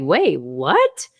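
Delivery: retold double-take, rising to a squeak; stunned disbelief. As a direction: voice leaping up on "what". Retold shock at winning.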